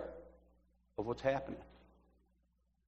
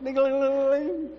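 high, rapid sing-song jingle; ringing bell imitation; 10 semitones higher than usual. Former altar boy mimicking the bells at communion, ringing bell imitation. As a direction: trill it like little altar bells. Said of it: "I love to ring those bells".

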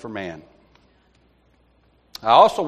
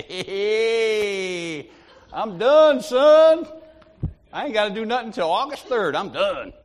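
high, crowing boast with a drawn-out greeting; bragging; 7 semitones higher, 4 dB louder, slower than usual. Retold or imitated. Bragging to Bob about being finished, gloating boast. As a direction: crow it like someone let off the hook. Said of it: "I was bragging".

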